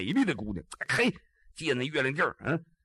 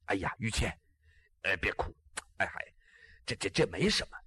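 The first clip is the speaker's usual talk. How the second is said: softer, lower, gently comforting; soothing; 8 semitones lower, 6 dB quieter than usual. Acted. Ji Xiaolan consoling the crying girl, in a soft soothing voice. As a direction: hush her tears tenderly.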